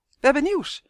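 high, loud herald; high-voiced little messenger; twin's announcement. A narrator's reading. First twin announces news.

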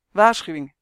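loud, sudden warning call; uitgeroepen waarschuwing. A narrator's reading. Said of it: "riep Quark". (luid waarschuwend roepen)

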